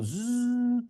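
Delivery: high, sustained "zzz"; speeding machine buzz. Acted. Machine racing as mom cries, high buzzing. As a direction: imitate a sewing machine racing. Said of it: "the faster the machine went".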